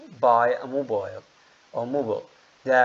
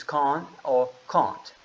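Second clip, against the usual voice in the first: British accent, "can or can't" with "can't" given the long ah of car; slower than usual. Shown as a demonstration. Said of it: "British accent is".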